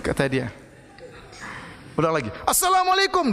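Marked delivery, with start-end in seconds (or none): 2.5-3.3 high, loud salam called out to the statue; loud shouted greeting. Retold or imitated. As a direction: shout the greeting at the idol on purpose. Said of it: "dengan suara yang keras". (salam diteriakkan keras dan tinggi)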